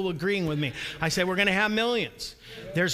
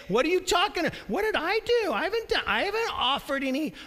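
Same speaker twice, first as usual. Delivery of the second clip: high, whining protest of innocence; whiny denial; 7 semitones higher than usual. Retold or imitated. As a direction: deny it like a sulky child. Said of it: "He's saying".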